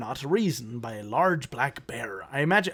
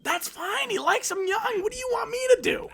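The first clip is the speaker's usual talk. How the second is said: high, fast, yelling defense; mock shout. Mocking a fan defending Leo, high shouting.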